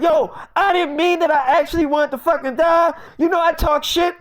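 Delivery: high, loud pleading; acting out a frantic friend; panicked protest. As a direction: plead as if wounded, voice way up. Acted protest after being shot.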